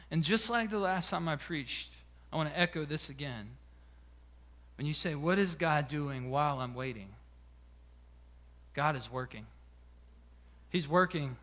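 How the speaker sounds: low, very soft preaching; hushed reassurance. Real, not acted. Preacher reassuring those who wait on God, quiet and low. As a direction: drop right down and let it land gently.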